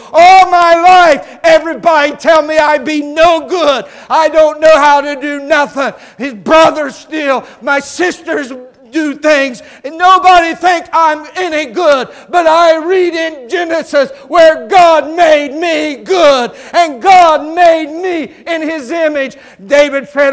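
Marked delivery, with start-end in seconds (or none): 0.1-1.4 a much louder, higher, slow cry of all my life; the boy's voice breaking as he cries it out to the church. Retold or imitated. Richard's tearful first sermon. 1.4-19.4 put-on a broken young preacher weeping his testimony aloud; tearful shout. Richard's tearful first sermon.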